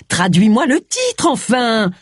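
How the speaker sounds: high, drawn-out exasperated demand; impatient shout. Acted. Agatha demanding James translate the headline, exasperated. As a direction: burst out, raising pitch and volume.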